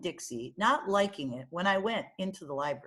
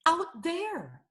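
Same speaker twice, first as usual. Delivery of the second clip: high, shaken gasp of a word; an old woman on the floor, still scared of a bear; frightened old lady; 10 semitones higher than usual. Acted. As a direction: breathe it out in fright. Frightened librarian points.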